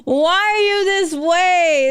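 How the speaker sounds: high, laughing why are you this way; laughing disbelief. Real, not acted. Laughing at a viewer comment.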